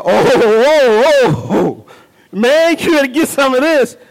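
drawn-out, savoring excited ohs; enthusiastic. Shown as a demonstration. Preacher enticing the congregation to try cake, enthusiastic. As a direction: rave about it with rising relish. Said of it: "when somebody gives you that enthusiasm, that excitement".

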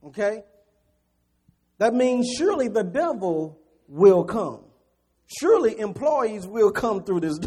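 loud, high, driving sermon delivery; fired-up preacher pounding home the point; preaching at full force. Acted. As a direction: preach it hard to the congregation.

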